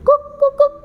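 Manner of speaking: high, short clucks; failed rooster crow. Acted. Ciki cannot imitate father rooster, high stuck clucks. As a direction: cluck where the crow should come. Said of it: "tapi dia tidak bisa".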